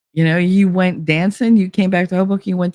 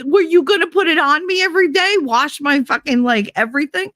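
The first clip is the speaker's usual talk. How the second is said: sarcastic outrage; 11 semitones higher than usual. Retold or imitated. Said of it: "I was like".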